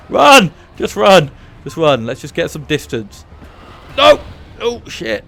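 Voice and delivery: loud high yell to flee; panicked shout. Real, not acted. Fleeing monster in game.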